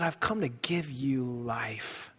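gentle, hushed scripture quote; quoting Jesus softly. Acted. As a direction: speak the words of Jesus as a hushed promise. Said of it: "Jesus said".

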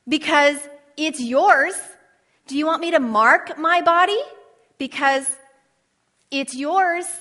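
loud, rising preacher's plea, slowed on key lines; passionate preaching. Acted. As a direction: pour it out like an altar call.

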